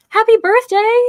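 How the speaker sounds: high, loud happy birthday; excited birthday wish. Real, not acted. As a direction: cheer it to the viewer. Greeting a viewer on her birthday.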